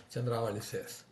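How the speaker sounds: usual speaking voice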